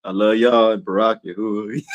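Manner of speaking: very low, quiet, mellow murmur; soft Shaquille voice. Retold or imitated. Preacher doing Shaquille's sign-off to his viewers, low and soft. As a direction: drop way down and soften like a gentle giant. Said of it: "Shaquille be talking like that".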